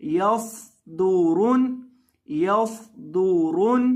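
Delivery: यसदुरुन slowly, heavy sound, whistled सीन; drawn-out यसदुरुन with a hissing स; recitation drill. Shown as a demonstration. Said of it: "सीटी की आवाज़ पैदा करके पढ़ेंगे". (यसदुरुन धीरे, सीटी के साथ)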